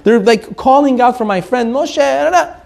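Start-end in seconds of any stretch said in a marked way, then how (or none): none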